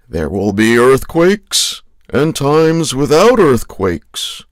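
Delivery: loud mock-scripture proclamation; mock prophecy. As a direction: declaim it like a doomsday prophet. Parodies the prophecy just quoted.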